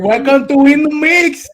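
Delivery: put-on high, loud, drawn-out Spanish-accented woman; slow, high-pitched Spanish señora announcing loudly. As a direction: belt it out as a Spanish-accented lady. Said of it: "I chose that Spanish woman voice".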